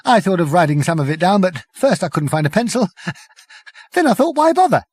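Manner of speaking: high, fast, laughing through; giggly Prack. A narrator's reading. Prack to the crew, high and giggling. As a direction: chuckle as you tell it.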